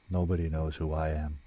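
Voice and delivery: low, soft, withdrawn; hopeless. A narrator's reading. Woman turning her head away from rescuer, low hopeless murmur. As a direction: say it low and quietly, turning inward.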